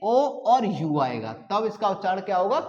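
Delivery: normal talking voice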